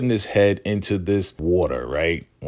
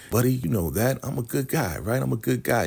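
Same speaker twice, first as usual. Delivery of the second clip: pleading father; 6 semitones higher than usual. Retold or imitated. Handcuffed dad convincing his son he's good, high pleading. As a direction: coax the kid like a desperate dad.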